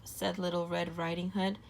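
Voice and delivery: ordinary delivery, nothing marked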